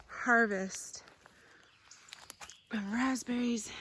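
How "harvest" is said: ordinary talk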